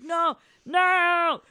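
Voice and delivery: high, loud wailed refusal; mock panicked protest. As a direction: mimic a desperate villain crying out. Acting out villain's panicked protest.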